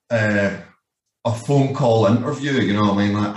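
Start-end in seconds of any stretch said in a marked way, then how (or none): none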